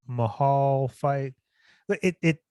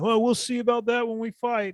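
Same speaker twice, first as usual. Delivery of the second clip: higher, puffed-up imitation of a rival's threat; mock Sterling reply; 10 semitones higher than usual. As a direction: parody a smug comeback.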